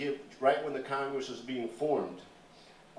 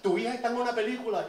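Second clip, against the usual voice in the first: high, fast Spanish announcement; quick high thrilled neighbor; excited neighbor; 9 semitones higher, faster than usual. Retold or imitated. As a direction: rush to tell the father the news. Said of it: "somebody says".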